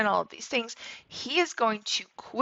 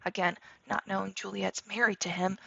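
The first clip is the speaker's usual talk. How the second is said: ordinary delivery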